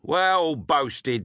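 sarcastic "Well boasted", leaning on "Well"; mocking praise. A narrator's reading. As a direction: jeer at the boy's boast. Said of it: "At this all laughed aloud".

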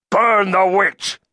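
high, loud, drawn-out shout; shouted command. A narrator's reading. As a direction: shout it to rouse a mob. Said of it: "he shouted".

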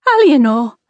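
loud, high cry of protest; shocked call of her name; alarmed outcry. A narrator's reading. Isabel cries out in alarm.

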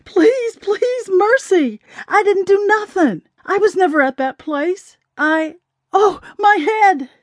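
high-pitched, pleading cry. A narrator's reading. Jeffrey begging the policemen beating him, crying. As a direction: beg for mercy in a high wail. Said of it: "listening to her brother cry".

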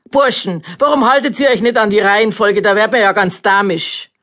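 high, loud fumbling announcer; flustered announcer. A narrator's reading. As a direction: stumble over the announcement, then scold. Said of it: "durch den Ansager unterbrochen".

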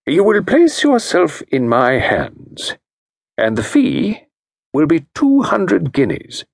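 slow, firm and measured; quietly firm consultant. Acted. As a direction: state the terms without doubt. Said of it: "spoke quietly and firmly".

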